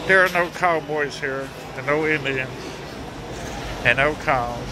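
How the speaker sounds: usual speaking voice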